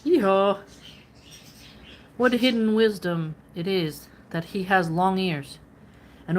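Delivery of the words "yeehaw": a short, louder hee-haw bray; donkey bray. A narrator's reading. The ass answering the litany with a loud bray. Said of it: "But the ass braid".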